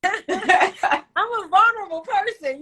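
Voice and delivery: slow words through real laughter; half-laughing, half-emotional confession. Real, not acted. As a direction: laugh it off while admitting it.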